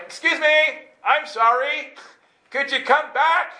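high, loud apologetic call; calling after someone. Retold or imitated. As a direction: plead loudly for the angel to return. Calls after the vanished angel.

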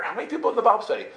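high, fast question; incredulous questioning. Retold or imitated. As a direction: fire off a disbelieving question. Acted questioning of friend.

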